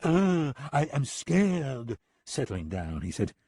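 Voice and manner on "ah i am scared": high, slow, hammed-up fright; a killer faking fear to taunt; mock fear. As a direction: pretend to tremble, mocking him. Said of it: "made a frightened face and said".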